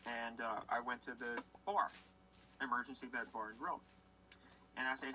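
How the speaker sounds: usual speaking voice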